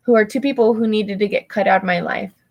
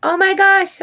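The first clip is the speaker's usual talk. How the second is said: sudden, much higher and louder gasp of words; surprised; 10 semitones higher, 5 dB louder than usual. Real, not acted. Reacting to a viewer in chat, real surprise. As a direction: caught off guard by the chat.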